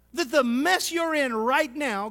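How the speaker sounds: normal talking voice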